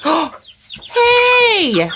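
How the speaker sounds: sudden high startled Hey; startled shout. Real, not acted. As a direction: jump at what the hen did. Hen pecks another hen.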